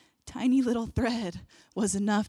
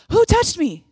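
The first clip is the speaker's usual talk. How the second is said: higher and louder, bursting with delight; giddy excitement; 9 semitones higher, 9 dB louder than usual. Retold or imitated. Jesus turning to the crowd, giddy and thrilled. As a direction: ask it like you can't wait to find out. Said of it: "And he was giddy. He was excited".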